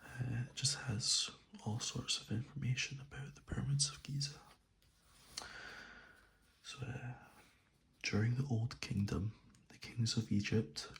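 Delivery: soft ASMR whisper; soothing spa whisper. Real, not acted. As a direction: whisper softly and close to the mic. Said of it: "I'm gonna do this in a soft spoken voice, as this is an A S M R video".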